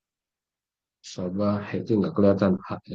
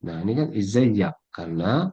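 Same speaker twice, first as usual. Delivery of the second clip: normal talking voice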